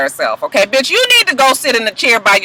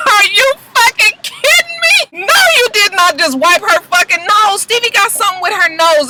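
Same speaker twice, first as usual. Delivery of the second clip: disbelieving shout; 8 semitones higher than usual. Retold or imitated. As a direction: yell it like the whole room can't believe it. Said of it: "everybody is just like".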